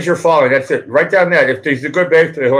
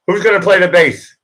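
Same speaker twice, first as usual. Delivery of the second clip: usual speaking voice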